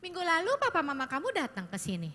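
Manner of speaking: usual speaking voice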